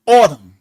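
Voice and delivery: "autumn" said loud and clear, OR-tum; example word. Shown as a demonstration. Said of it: "You got to take the word".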